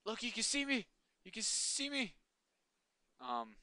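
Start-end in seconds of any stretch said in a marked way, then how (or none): 0.0-2.1 high, eager pointing out; thrilled to spot himself; excited. Real, not acted. Excited at own reflection.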